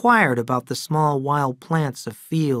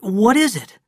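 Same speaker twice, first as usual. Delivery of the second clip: higher, louder anxious questions; husband anxiously asks the doctor about his wife, higher and louder; 8 semitones higher, 5 dB louder than usual. Acted. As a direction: blurt the questions out in worry. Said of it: "Adam was waiting anxiously downstairs".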